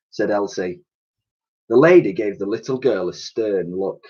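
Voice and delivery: ordinary delivery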